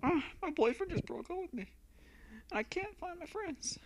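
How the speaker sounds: put-on high, soft whiny girl; upset girl voice. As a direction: whine like a heartbroken girl. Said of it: "let me try this".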